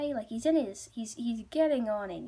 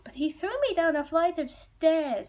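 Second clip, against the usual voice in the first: higher, louder retold complaint; aggrieved protest; 7 semitones higher, 7 dB louder than usual. Priest complains to the father about his son, aggrieved. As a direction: protest it, pitched up and loud.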